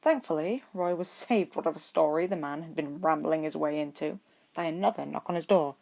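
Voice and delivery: ordinary delivery, nothing marked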